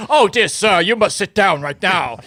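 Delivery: high, stuffy British referee; posh referee voice. Pretend. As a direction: scold like a pompous chairman. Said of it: "It's like".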